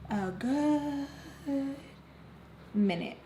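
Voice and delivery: long, lifted GOOD, then a pause before "minute"; "good" held high and long, "minute" dropped after a gap; drawn-out emphasis. Real, not acted. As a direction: stretch "good" way out. Repeats phrase, stretched for emphasis.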